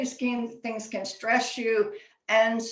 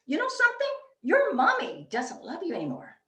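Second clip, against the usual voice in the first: put-on taunting kid; 7 semitones higher than usual. Kid taunts crying friend.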